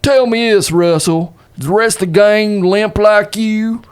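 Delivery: put-on high, drawling old-west lawman; cowboy lawman. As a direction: press him like a sheriff. Player voices Dave interrogating.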